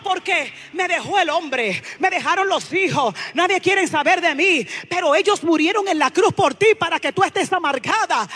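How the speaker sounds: loud, driving sermon at full force; fiery preaching. Acted. Preacher stirring the congregation, loud fervent preaching. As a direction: preach it out with rising passion.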